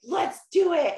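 the gung-ho side of you cheering; bright pumped-up yell of let's go; eager inner voice. Retold or imitated. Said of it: "The one that's like".

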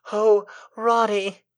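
high, drawn-out damsel cry; heroine's cry. A narrator's reading. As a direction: swoon it slowly to your rescuer. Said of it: "she cried".

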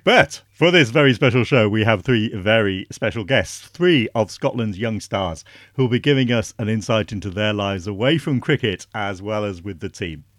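loud, upbeat announcer read; radio presenter intro. Acted. Podcast host introducing the special guests, loud presenter read. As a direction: sell the special show to listeners.

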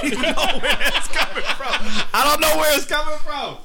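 high, giggling while talking; words through giggles; laughing. Real, not acted. Laughing at own silly voice.

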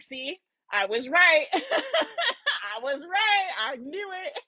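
mock vindicated, laughing through it; acting out smug vindication while laughing; laughing told-you-so. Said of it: "that I am acting out for you guys".

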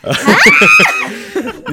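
shrieking laugh; high burst of laughter. Real, not acted. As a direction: let out a high whooping laugh.

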